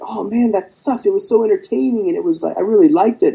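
mock the fans who loved the film; mimicking gushing fans. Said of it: "I've heard a lot of people like".

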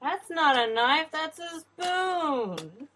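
hammed-up Australian quote, drawing out "spoon"; Crocodile Dundee Australian. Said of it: "Nobody can do an Australian accent".

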